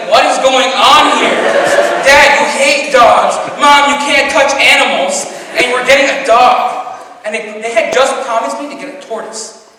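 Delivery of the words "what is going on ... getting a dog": louder, incredulous, slowing on the key words; exasperated disbelief. Retold or imitated. Teen baffled at parents buying a dog, loud disbelief. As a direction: protest a ridiculous family decision. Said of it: "I'm just like".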